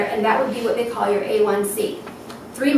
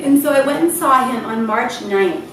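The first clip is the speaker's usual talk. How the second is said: usual speaking voice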